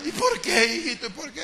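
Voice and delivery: laughing imitation. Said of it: "me decía".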